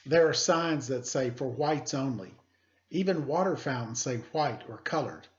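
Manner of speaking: teacher reading a picture book aloud; story reading. Acted. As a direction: clear read-aloud storytelling voice.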